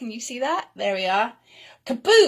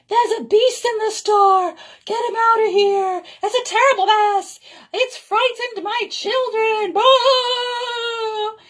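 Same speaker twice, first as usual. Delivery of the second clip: high, loud complaining customers; outraged shoppers; 11 semitones higher, 6 dB louder than usual. Acted. Customers yelling complaints at the shop manager, high and loud. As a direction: shout your outrage at the manager.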